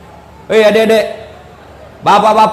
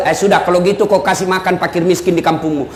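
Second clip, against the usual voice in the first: calm Prophet; 6 semitones lower, 3 dB quieter than usual. Acted.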